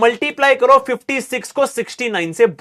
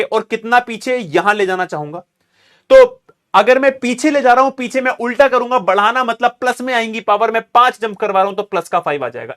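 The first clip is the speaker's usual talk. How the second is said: usual speaking voice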